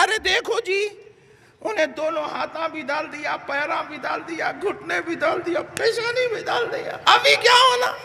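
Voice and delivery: high, excited pointing out; excited accusing. Retold or imitated. Opponent pointing out the kneeling devotee, excited accusing. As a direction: point and cry foul.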